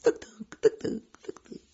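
whispered tuk-tuk taps; woodpecker tapping. Retold or imitated. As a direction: tap it out like a woodpecker. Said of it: "дятлы перестукиваются".

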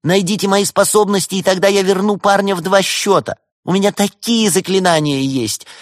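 high, loud insistent pleading; urgent high-pitched bargaining; imploring. A narrator's reading. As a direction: plead your case at full pitch. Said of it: "взмолилась Валерия".

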